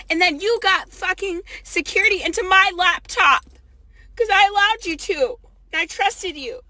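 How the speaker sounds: loud, rising angry outburst; furious scolding. Real, not acted. Berating person who took laptop.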